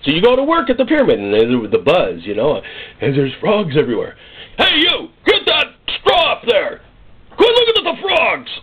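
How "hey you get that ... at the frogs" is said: high, barking taskmaster voice; slave driver yelling. Acted. Egyptian taskmaster shouting at a slave over the frogs, high and barking. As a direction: yell orders down at a distracted slave.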